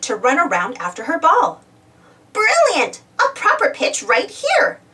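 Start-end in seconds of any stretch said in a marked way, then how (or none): 2.3-4.8 high delighted squeal; thrilled at the new pitch. A narrator's reading. Wendy booting the football, squealing with delight. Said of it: "she squealed".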